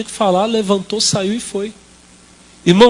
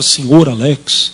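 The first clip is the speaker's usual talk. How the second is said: joking pastor; 6 semitones lower, 3 dB louder, much slower than usual. Retold or imitated. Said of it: "era o jeitão dele".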